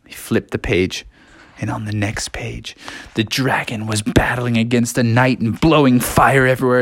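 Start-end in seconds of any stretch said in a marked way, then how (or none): none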